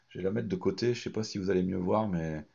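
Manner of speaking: normal talking voice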